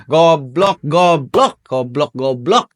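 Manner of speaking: annoyed swearing. Real, not acted. Vlogger cursing at a foolish character, loud and fed up. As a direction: blurt it out at the dumb character.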